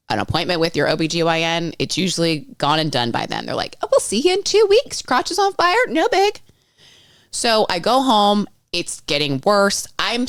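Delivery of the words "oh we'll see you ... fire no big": much higher, louder, breezy fake-cheery mockery; mock dismissive staff. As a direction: ham up a careless receptionist brushing her off. Mocking dismissive clinic staff.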